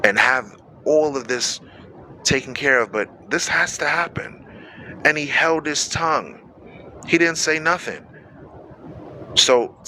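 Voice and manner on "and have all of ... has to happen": calm inner thought. Retold or imitated. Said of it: "he's thinking to himself".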